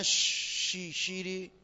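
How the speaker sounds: slow halting شی- then شیری, cut into pieces; syllable-by-syllable reading. Shown as a demonstration. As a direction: sound out شی- شیری slowly like a weak reader.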